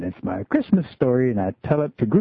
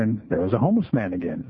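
ordinary talk

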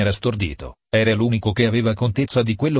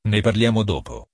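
ordinary delivery

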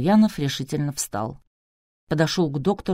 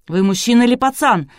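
louder, higher, scornful outburst; exploding in anger; 5 semitones higher, 6 dB louder than usual. A narrator's reading. Man berates the sobbing doctor, exploding. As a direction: lash out at a weakling. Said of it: "взорвался Клычко Жиляев".

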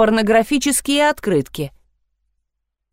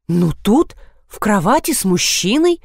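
plain reading voice

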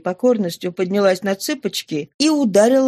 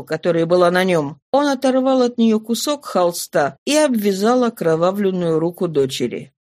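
usual speaking voice

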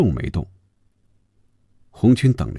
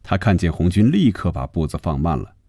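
plain reading voice

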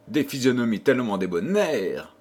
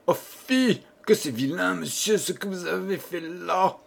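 much higher, outraged reproach; indignant scolding; 6 semitones higher than usual. Acted. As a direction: shame the tiger, thoroughly indignant. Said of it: "dans son indignation".

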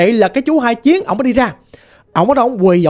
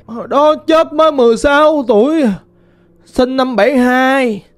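lightly voiced ghost girl, plaintive and mournful; a hint of a lost spirit lamenting; 3 semitones higher than usual.

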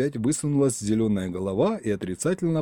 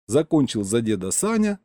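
ordinary delivery, nothing marked

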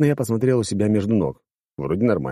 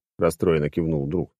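usual speaking voice

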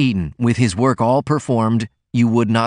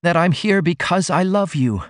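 tearful plea; 8 semitones higher than usual. A narrator's reading. Ruth pleading tearfully.

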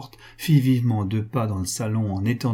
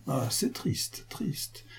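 slow, hushed lament, fading to a whisper; sorrowful lament; 3 semitones higher, 11 dB quieter, much slower than usual. A narrator's reading. Pascal lamenting his friends' cold refusal, hushed and sorrowful. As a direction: let the words die away in a sigh.

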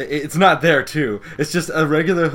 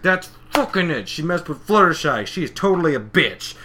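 mock fed-up anger; 6 semitones higher, 5 dB louder than usual. Retold pony losing her temper.

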